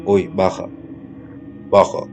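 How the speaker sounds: low, lightly voiced man calling; man's voice. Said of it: "como la voz como de un hombre".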